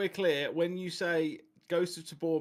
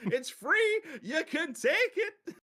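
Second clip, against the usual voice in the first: much higher, a southern shopkeeper offering a gun; high-pitched shopkeeper bit; 10 semitones higher than usual. Retold or imitated. Telling friends about a TikTok shopkeeper, high mock southern voice. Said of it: "And the guy was like".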